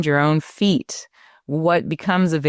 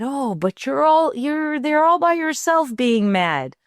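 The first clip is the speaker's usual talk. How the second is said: put-on much higher, sing-song coaxing to a child; mother coaxing her angry five-year-old, high and playful; playful sympathy; 10 semitones higher than usual. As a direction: coax her like a small child. Mother soothing angry young daughter.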